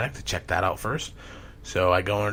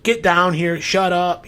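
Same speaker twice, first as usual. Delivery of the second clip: raised-pitch, loud imitation of a scolding mother; retold scolding; 8 semitones higher, 6 dB louder, slower than usual. Mom ordering her screaming son downstairs, scolding loudly.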